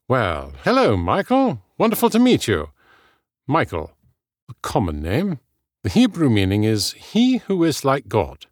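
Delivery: high, swooping British effusiveness; British accent. A narrator's reading. Hugo greeting Michael and explaining his name, British charm. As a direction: gush smoothly in a British accent. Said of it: "had to have a British accent".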